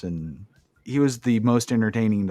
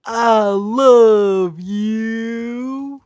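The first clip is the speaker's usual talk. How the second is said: loud, high, hugely drawn-out catchphrase; Brother Love; 13 semitones higher, 8 dB louder, much slower than usual. Retold or imitated. Podcaster mimicking wrestling character's entrance catchphrase, hammed-up drawn-out cry. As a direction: stretch every word out in a hammed-up mock-preacher call. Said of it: "Brother Love comes out and he does this".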